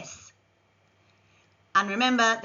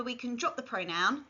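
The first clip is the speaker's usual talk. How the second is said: ordinary delivery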